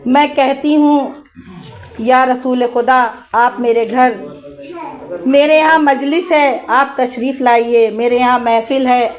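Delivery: ordinary talk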